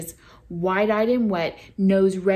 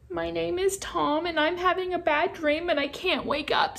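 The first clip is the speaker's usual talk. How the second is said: put-on small child voice; 9 semitones higher than usual. A narrator's reading. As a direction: speak up high like a lost small child. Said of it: "said the child".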